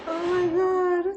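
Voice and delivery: high, breathless laughing exclamation. Real, not acted. Mother cracking up over her son's comment. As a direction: gasp it out mid-laugh.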